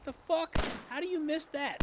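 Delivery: high, incredulous outburst; can't believe he missed; stunned disbelief. Real, not acted. Player baffled after missing the roach, incredulous.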